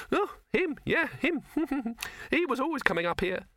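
very high rabbit voice with a laugh; chuckling rabbit. Acted. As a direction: squeak it dismissively with a chuckle.

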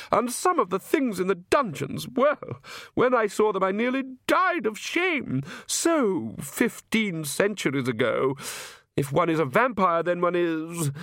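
high-pitched aristocratic lady, airily amused; haughty vampire countess. Acted. Countess musing to guests on spilt blood, airily haughty. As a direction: lilt it high like a grand countess.

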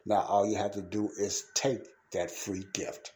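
ordinary talk, nothing marked

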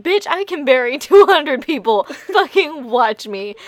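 loud, indignant boast, the last words slowed; a mock-furious challenge, slowing to a dare at the end; mock anger. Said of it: "Inosuke gets so fucking angry".

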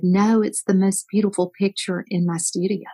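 usual speaking voice

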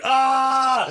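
sudden high, loud yell; imitated scream. Balcony man interrupting the phone exchange, screaming. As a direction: cut in with a screamed "ah". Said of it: "the screaming guy's just".

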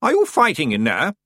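a suspicious aunt calling in. A narrator's reading. Aunt calls through door.

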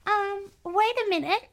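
put-on squeaky high child asking; little kid voice. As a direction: play a worried little boy, way up high. S2 voices little brother Nolan.

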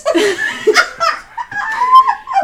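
high burst of loud laughter; real shrieking laugh; big laugh. Real, not acted. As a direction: crack up loudly. Laughing at own story.

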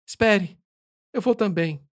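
high calling voice. Acted. Rafael calls out to narrator.

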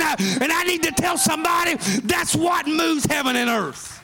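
shouted, high-pitched sermon run; exultant preacher reliving his parking-lot shout; full-force preaching. Acted. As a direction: holler the testimony out. Preacher climbs back into shouting.

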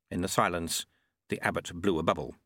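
usual speaking voice

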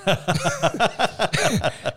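high, loud burst of laughter. Real, not acted. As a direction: crack up at your own story. Laughing at his own story.

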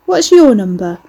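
loud, leering Cockney question; passable Cockney accent. A narrator's reading. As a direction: chat her up in rough Cockney. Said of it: "he leered in a passable Cockney accent".

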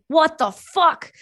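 slow, high disbelief; shocked sergeant. Retold or imitated. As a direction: draw it out in shock. Sergeant reacting to pills.

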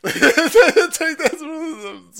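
high, loud laugh through the words. Real, not acted. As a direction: crack up mid-sentence. Cracks up laughing.

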